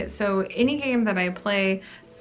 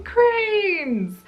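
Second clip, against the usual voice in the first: high, drawn-out cheer of the word; playfully stretched, high-pitched reveal; playful announcement; 11 semitones higher than usual. Real, not acted. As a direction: sing it out like a big reveal. Drawn-out announcement of favorite animal.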